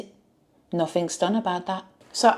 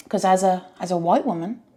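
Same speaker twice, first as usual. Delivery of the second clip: ordinary talk, nothing marked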